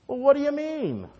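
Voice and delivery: high-pitched puzzled innocence; clueless assistant. Acted. Slacking assistant answers the angry owner, high clueless protest.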